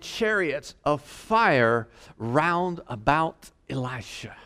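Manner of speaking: slow, measured King James reading; scripture reading. Acted. Preacher reading Second Kings to the congregation, measured scripture reading. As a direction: read it like the pulpit Bible.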